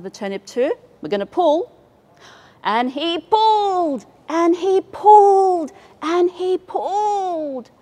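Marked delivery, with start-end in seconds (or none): 2.6-7.7 high, slow, straining heave; slow effortful pulling chant. A narrator's reading. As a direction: heave each word like pulling hard.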